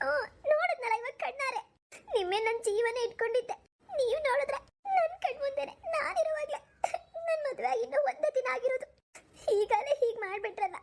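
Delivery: put-on high, tearful young wife's voice, breaking into sobs; a hurt bride crying and accusing her husband; wife crying over the husband she thinks betrayed her; tearful new bride.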